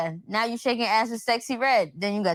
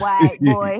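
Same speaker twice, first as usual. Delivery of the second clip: mock quote; 8 semitones lower, 7 dB louder than usual. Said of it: "he was like".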